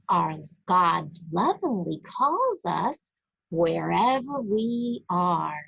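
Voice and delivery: ordinary delivery, nothing marked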